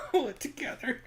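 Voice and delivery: words squeezed out through high laughter; trying to talk while cracking up; giggling, barely getting it out; laughing. Real, not acted. Cracking up mid-sentence.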